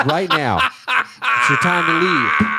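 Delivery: high, slow, put-on bartender voice. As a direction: play the bartender ordering him out. Bartender throwing him out.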